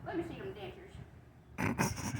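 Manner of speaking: manly voice. Acted. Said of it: "speaking of manly voice".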